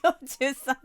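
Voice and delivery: loud, excited greeting; excited shout. Real, not acted. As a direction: call out the name with joy. (興奮して大声で呼ぶ)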